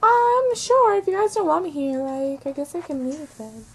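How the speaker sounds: high voice, sinking and fading as it goes; sad. Acted. Britney told to skedaddle by Ken, sad. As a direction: start high, then trail down dejectedly.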